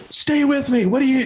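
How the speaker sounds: high, urgent reenacted pleading; movie-style desperate plea. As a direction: beg like a movie rescuer. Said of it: "I did what I saw in the movies".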